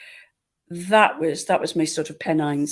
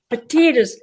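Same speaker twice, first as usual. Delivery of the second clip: "potatoes" as "puh-TEE-ders", higher, in mock Irish; hammed-up Irish accent on "potatoes", pitched up; Irish-accent attempt; 4 semitones higher, 4 dB louder than usual. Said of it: "it was the way I said potatoes".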